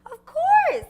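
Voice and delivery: high, loud indignant burst; excited shout. Acted. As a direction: blurt it out, offended.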